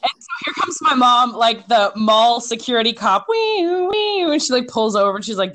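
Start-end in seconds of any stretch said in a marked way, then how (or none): 3.3-4.2 high mock police siren, wee wee. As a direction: play the siren as mom pulls up. Imitating mom's arrival as siren.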